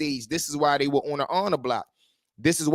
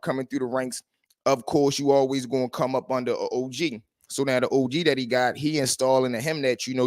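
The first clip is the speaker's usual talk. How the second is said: ordinary delivery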